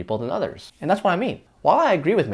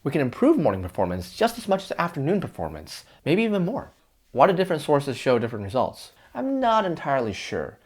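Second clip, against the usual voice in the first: ordinary talk, nothing marked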